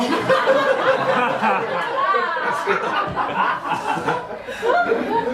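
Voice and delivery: long high burst of laughter; big laugh. Real, not acted. Laughing at the awkward kiss question she retells. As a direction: let the laugh rise high and ring out.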